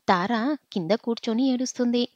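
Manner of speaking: ordinary delivery, nothing marked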